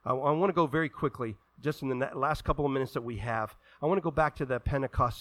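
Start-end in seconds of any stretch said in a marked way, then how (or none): none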